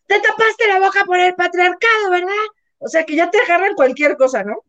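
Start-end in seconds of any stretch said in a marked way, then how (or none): 0.1-2.3 higher, put-on accusing voice; mock feminist accuser. Mocking a woman who blames sneezing etiquette on patriarchy, higher voice. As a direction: lightly parody a preachy scold.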